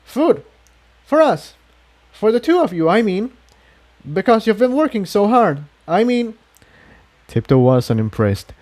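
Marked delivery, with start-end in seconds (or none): 0.0-6.3 high, flustered, tumbling excuses; a nervous young mare covering herself; flustered young filly. A narrator's reading.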